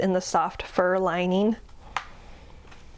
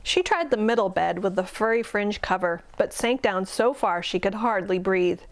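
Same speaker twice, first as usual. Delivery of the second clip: ordinary delivery, nothing marked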